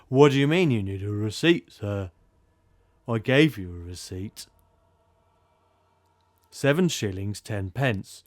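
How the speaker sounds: put-on loud, fussy British accent; a British ticket clerk complaining at full volume; grumbling British clerk. Game master voicing the gramophone to players, loud British grumble. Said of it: "this grumbling British voice goes".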